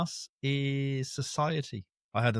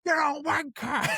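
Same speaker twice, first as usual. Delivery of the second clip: very high mocking insult; mock angry bloke. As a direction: spit the parting shot as the customer. Customer's parting insult voiced high.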